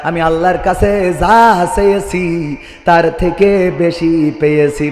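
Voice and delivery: normal talking voice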